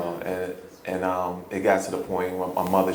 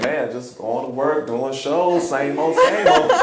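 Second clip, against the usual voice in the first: higher, louder mock-patient repeat; sarcastic; 6 semitones higher, 6 dB louder, slower than usual. Son repeating himself to a forgetful James, sarcastic. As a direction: repeat it pointedly, laughter breaking in at the end. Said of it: "I got a little sarcastic".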